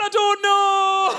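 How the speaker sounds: high, stretched mock wail; helpless wail. Wife holding the mess, helplessly wailing. Panicked wife has no answer.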